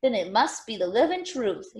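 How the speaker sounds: ordinary delivery, nothing marked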